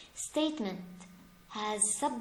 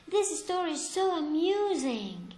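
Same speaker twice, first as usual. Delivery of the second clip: higher and louder, full of delight; amused exclamation; 5 semitones higher, 5 dB louder than usual. Shown as a demonstration. Teacher reading an example to the class, delighted. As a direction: read it like the story really tickles you. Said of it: "It's an exclamation".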